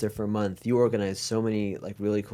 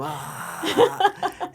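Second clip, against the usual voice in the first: mock scream. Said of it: "I didn't think I was screaming".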